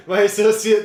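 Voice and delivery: mock accomplice voice. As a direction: echo the line mockingly. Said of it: "did she say?".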